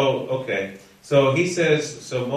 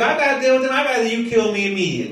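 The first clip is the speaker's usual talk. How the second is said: high, rushed exasperated outburst; complaining; 8 semitones higher, much faster than usual. Acted. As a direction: rant it at God, hammed-up and fed up. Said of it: "This is how he's talking".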